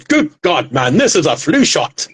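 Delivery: lightly done English toff doctor; mild posh English outburst. Retold or imitated. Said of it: "like this posh English doctor".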